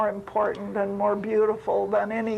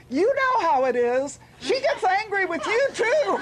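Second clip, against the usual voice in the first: high, gleeful punchline; rising into laughter as the joke lands; teasing; 9 semitones higher than usual. Retold or imitated. Swami joking to Ray about her anger, teasing. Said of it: "Swami teased me".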